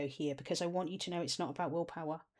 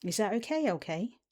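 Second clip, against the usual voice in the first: normal talking voice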